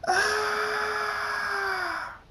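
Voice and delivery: long, very high scream; agonized scream. A narrator's reading. Six lightning bolts strike Draconix, screaming. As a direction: sustain a high scream of pain. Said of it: "Draconix screams".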